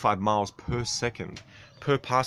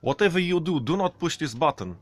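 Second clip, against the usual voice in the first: Russian instructor; 7 semitones higher than usual. Retold or imitated. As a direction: bark it like the Russian flight instructor. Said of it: "And he's like".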